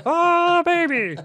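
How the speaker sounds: very high, loud announcer's exclamation; Bob Cole. Acted. Showing off his impression to the co-host, loud announcer voice. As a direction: belt it out as a hammed-up play-by-play caller. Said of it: "Yes, my Bob Cole voice".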